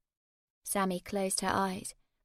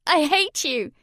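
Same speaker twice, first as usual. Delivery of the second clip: high, louder furious shout; 8 semitones higher, 12 dB louder, slower than usual. A narrator's reading. Sammy shouting at her mum. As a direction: scream it at her. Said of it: "Sammy shouted".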